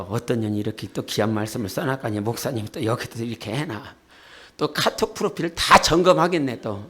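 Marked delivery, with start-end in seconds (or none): 0.0-3.9 low, quiet inner grumbling; 속으로 하는 말. Retold or imitated. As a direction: imitate someone thinking to themselves, soft and low. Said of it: "또 속으로 그럴까?". (속으로 중얼거리는 낮은 목소리 흉내)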